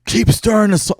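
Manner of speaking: high, loud mock yell of an angry grandfather; grandfather screaming. Grandfather yelling at grandma in the kitchen, high mock scream. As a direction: shriek it like the old man at the stove. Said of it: "just screaming constantly".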